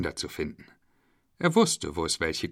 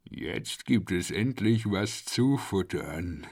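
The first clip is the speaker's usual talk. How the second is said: gleeful crocodile voice; 3 dB quieter, slower than usual. Acted. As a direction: relish the coming meal, slowly. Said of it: "Das Krokodil freute sich".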